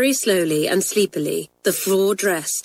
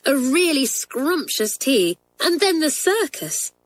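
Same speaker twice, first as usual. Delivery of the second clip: delighted anticipation; 7 semitones higher than usual. A narrator's reading. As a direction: relish every word. Narrator savours treat.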